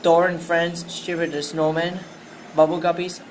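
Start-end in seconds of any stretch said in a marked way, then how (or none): none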